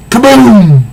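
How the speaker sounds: loud, high comic-book kaboom; explosion sound. Acted. Reader voicing the big explosion, loud kaboom. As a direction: shout the explosion like a sound effect.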